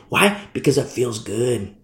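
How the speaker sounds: ordinary talk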